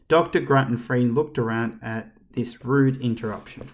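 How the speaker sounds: ordinary delivery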